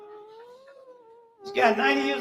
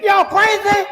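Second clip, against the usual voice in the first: louder and higher, scolding the room; mock outraged shout; 10 semitones higher, 13 dB louder, slower than usual. Comic scolding the audience with an outraged shout. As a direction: bark it at the whole crowd like a fed-up preacher.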